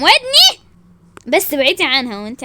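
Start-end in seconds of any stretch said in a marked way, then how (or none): 0.0-0.6 loud, very high cry; pained yelp. Acted. Woman's ear is grabbed in fight, yelping.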